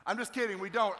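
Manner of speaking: normal talking voice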